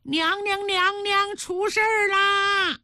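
put-on high-pitched child's alarm cry, drawn out at the end; child calling out. A narrator's reading. Boy running to alert the queen, high alarmed call. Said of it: "一边跑一边喊".

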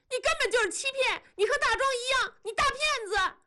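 very high, rapid-fire accusations; yelled tirade. A narrator's reading. Wife lunges at husband listing his faults, high yelled tirade. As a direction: reel off grievances at a piercing pitch. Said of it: "喊".

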